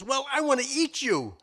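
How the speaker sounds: threatening wolf. Acted. Wolf telling the rabbit he wants to eat him, high and loud. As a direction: announce the hunger to the rabbit.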